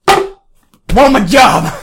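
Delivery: loud, slow, laughing bellow; laughing mock shout. Real, not acted. Mock boast, friend says calm down.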